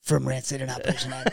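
quick raspy punk-singer imitation. Filmmaker mimicking the singer's distinctive voice, quick raspy impression. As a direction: rattle it off raspily, imitating a singer. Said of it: "that raspy voice".

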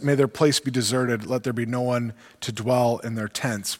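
scripture reading. Acted. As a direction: read the curse gravely. Reads psalm aloud.

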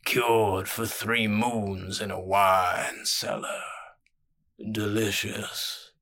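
very slow, rolling menace; slow rumble. Acted. Crime boss offering the thief cured meat, slow rumble. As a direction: savour each word like a dangerous gourmand. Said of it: "he rumbled".

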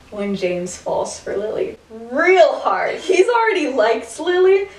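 normal talking voice

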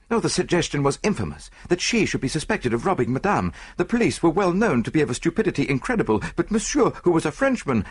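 French lady's maid protesting her innocence; indignant French maid. Acted. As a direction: pour it out in a rush of indignation.

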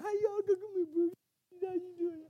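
high-pitched greeting to a dog; loud, high mock dog-talk; playful calling. Players calling over the dog.

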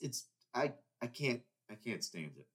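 ordinary talk

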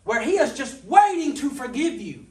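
ordinary talk, nothing marked

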